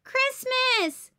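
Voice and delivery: high, loud call; waking everyone for Christmas morning; excited yell. Acted. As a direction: announce it like an alarm. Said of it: "Bluey yells".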